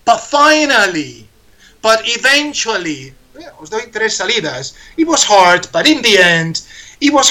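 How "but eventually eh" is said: ordinary talk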